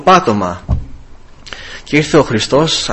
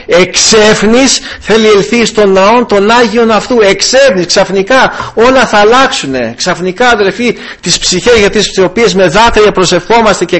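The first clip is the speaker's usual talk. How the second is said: fervent preaching; 4 semitones higher than usual. Acted. Pastor proclaiming sudden revival to brothers, fervently. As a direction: build the promise to the congregation.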